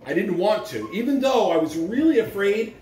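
gentle storybook read-aloud with pauses; reading to children. Acted. Principal reading a picture book to the school, gentle storyteller voice.

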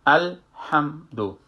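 الحمدو slow, the m held with no vowel; slow demo of الحمدو, mim with sukun. Shown as a demonstration.

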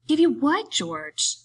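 lightly voiced simple fellow feigning puzzlement; playing dumb. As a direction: ask as if you had no idea. Lenny keeps playing dumb to George.

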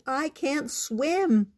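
high, slow little-frog complaint; bubbled protest. A narrator's reading. Froggy in the water to his mother, bubbled protest. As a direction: drag out the protest, lightly childlike. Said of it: "bubbled Froggy".

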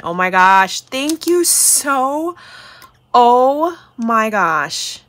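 loud, drawn-out thrilled thanks; excited. Real, not acted. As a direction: gush slowly over a gift. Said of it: "I'm so excited".

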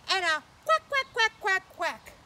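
high loud quacking; duck quacks. Retold or imitated. Kids' host quacking like a duck for children.

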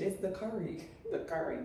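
soft laughing aside; half-whispered giggle; quiet. Real, not acted. Laughing aside about accent.

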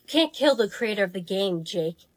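low, soft, menacing reveal; sinister. Acted. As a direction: drop into a quiet villain threat. Ominous villain reveal.

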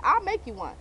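ordinary talk

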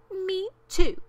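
high, loud little joey; tiny high-pitched echo of a parent; young kangaroo. A narrator's reading. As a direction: squeak it out like a copycat youngster. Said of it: "young kangaroo in her pouch said".

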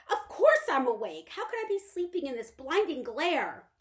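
a fragile mother lashing out at the light; irritable invalid mother. Acted. Bedridden mother snaps from bed.

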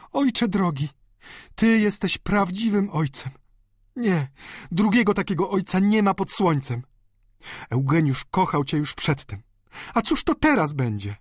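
put-on high-pitched, emotional young woman; affectionate daughter. A narrator's reading. Delfina praising her dear father, affectionately.

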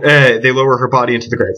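plain reading voice

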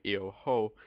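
"ho" with an audible English H; H wrongly pronounced. Shown as a demonstration. Teacher showing learners the mistake in "io ho", audible H on "ho". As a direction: sound the H at the start of "ho". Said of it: "If you pronounce the H".

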